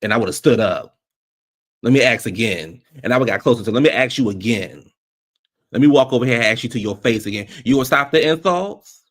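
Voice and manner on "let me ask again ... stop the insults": louder, pushy challenge; mock confrontation. Reviewer playing herself confronting Heavenly, louder. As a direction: get in her face with it.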